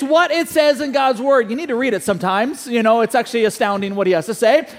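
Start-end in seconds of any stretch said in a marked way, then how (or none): none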